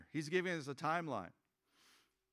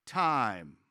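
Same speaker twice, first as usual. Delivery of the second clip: higher, louder reading of the verse; scripture reading; 6 semitones higher, 7 dB louder than usual. Acted. Pastor reading verse eighteen aloud, proclaiming. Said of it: "It says".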